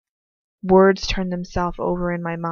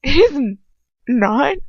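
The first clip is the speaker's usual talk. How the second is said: stammering; 7 semitones higher, 7 dB louder, slower than usual. Acted. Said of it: "I stammer".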